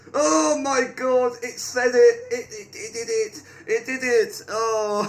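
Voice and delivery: loud, high excited outburst; thrilled disbelief. Real, not acted. Player seeing his prediction come true, loud excited disbelief. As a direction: cry out high and loud in amazement.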